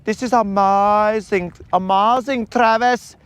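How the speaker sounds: put-on high, gushing wedding planner accent; hammed-up accented planner, drawing out the praise; Franz. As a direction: gush in a put-on high foreign voice. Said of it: "Creel's out there and he's like".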